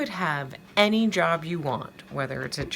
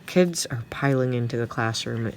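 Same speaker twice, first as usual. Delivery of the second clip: ordinary delivery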